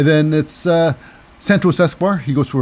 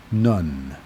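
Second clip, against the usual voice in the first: deadpan low; 10 semitones lower, 7 dB quieter than usual. Acted.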